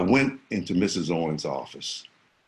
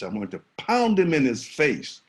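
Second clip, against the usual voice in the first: normal talking voice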